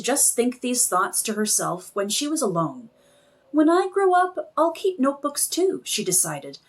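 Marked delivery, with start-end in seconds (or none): 3.5-5.8 put-on eager child. Child Margaret decides.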